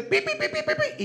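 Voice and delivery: very high-pitched rapid beeping; imitated alarm beeps. Son imitating his ankle monitor's alarm, high beeps. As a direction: mimic an electronic alarm going off.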